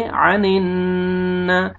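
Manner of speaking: "अनिन" with a long hummed N; slow chanted "अनिन", N stretched; ghunnah. Shown as a demonstration. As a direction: hold the nasal at the end of "अनिन". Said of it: "गुन्ना करेंगे".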